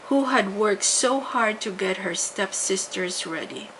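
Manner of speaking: usual speaking voice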